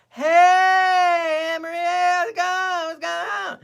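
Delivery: loud, high, drawn-out greeting call. Real, not acted. Host hails Maria mid-story, loud high calling out. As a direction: holler hello in a high stretched voice.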